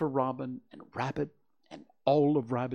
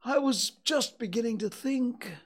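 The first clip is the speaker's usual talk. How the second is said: high-pitched, forlorn bear voice; a small bear close to sniffles; plaintive and high; sniffly bear; 12 semitones higher than usual. A narrator's reading. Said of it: "sniffing slightly".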